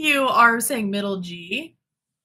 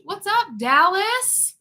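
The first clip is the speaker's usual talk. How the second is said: drawn-out high greeting; 12 semitones higher, 4 dB louder, much slower than usual. Real, not acted. Host greeting a Dallas viewer, slow and high. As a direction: stretch it slowly, pitched well up.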